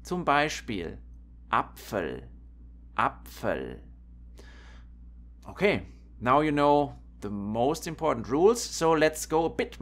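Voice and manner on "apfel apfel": low, careful "Apfel" with pf as one sound; lowered voice, "Apfel" with pf unseparated; pf pronunciation demo. Shown as a demonstration. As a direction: show "Apfel" with soft p into f mid-word. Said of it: "this combination in the middle of a word".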